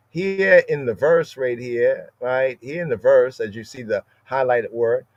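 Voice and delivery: usual speaking voice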